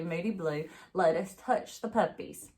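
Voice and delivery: plain reading voice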